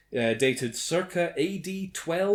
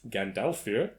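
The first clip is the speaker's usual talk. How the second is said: ordinary delivery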